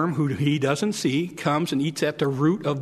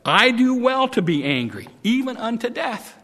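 defiant Jonah; 7 semitones higher, 3 dB louder than usual. Acted. Jonah answers God angrily.

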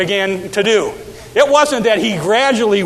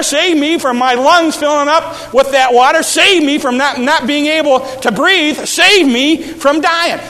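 higher, louder, slowing desperate begging; drowning man crying out louder; desperate plea; 6 semitones higher, 4 dB louder than usual. Acted. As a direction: plead for your life. Peter's desperate prayer acted.

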